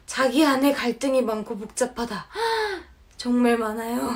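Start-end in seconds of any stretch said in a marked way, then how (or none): 2.3-2.8 sharp high gasp; surprised gasp. Real, not acted. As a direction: gasp in recognition. Shocked the description fits.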